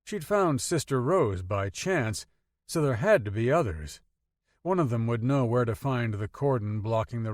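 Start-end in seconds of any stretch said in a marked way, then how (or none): none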